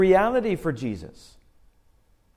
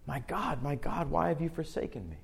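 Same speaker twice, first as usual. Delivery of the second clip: hushed anguished cry; 3 semitones lower, 10 dB quieter than usual. Acted. Quoting Jesus' cry from cross.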